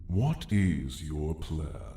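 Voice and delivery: ordinary talk, nothing marked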